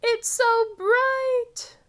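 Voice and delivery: slow wonder at the light; amazed. A narrator's reading. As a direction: stretch it out in awe.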